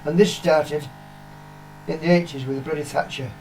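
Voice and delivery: ordinary talk, nothing marked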